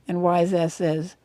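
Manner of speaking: ordinary talk, nothing marked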